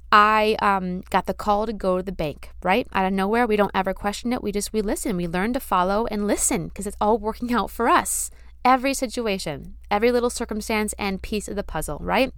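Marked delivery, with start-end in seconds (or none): none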